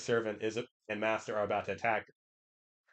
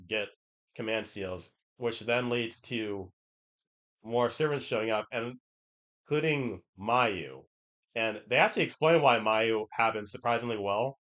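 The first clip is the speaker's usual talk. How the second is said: ordinary talk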